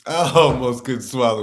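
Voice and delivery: ordinary delivery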